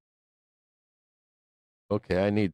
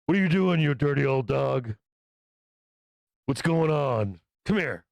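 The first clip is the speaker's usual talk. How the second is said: playful alpha command; 3 semitones higher than usual. Shown as a demonstration. Owner to his dirty old dog, playfully bossy. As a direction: rib the dog while ordering it over. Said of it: "so I gotta be like".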